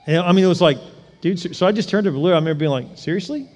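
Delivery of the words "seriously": soft, high questioning disbelief; incredulous. Retold or imitated. Preacher to man crowding him at ATM, quiet disbelief. Retold reaction to intrusive man.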